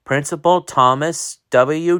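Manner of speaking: usual speaking voice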